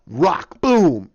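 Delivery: loud, punched single words; booming impact. Acted. Fan narrates Hulk's rock smash, booming and loud. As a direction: slam each word like a falling rock.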